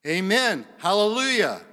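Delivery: high, full-voiced shout of praise; loud worship cry. Elders worshiping at the throne, high loud acclamation.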